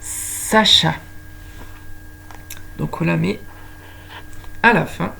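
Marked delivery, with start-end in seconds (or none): 0.0-0.9 "Sacha" with a long hissed first s; "Sacha" with its opening sound stretched; stressed initial S. Shown as a demonstration. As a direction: hiss into the name: Sssacha. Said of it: "S comme".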